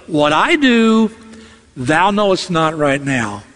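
slow contrast of "I" against "thou"; stress on I and THOU. Shown as a demonstration. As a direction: draw out "I", pause before "thou". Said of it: "emphasis on I and thou".

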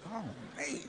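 soft, resigned aside; exasperated sigh. Retold or imitated. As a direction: groan it under your breath at the crying caller. Exasperated at the crying caller.

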